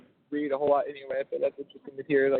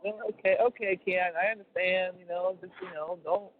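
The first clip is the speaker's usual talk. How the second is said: higher-pitched Cajun mom; Cajun mom impersonation; 4 semitones higher than usual. Retold or imitated. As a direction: imitate a Cajun mother gently cautioning. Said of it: "impersonation of your mom".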